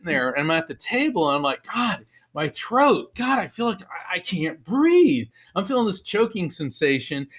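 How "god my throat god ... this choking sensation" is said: mock distress. Retelling his choking spell at the restaurant table, mock distress. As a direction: act out a higher-pitched choking complaint.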